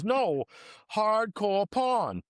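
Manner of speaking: high, very slow imitated Brooklyn accent, "porn" as "pawn"; insistent Brooklyn accent. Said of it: "She goes".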